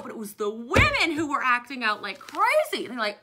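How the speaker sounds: ordinary talk, nothing marked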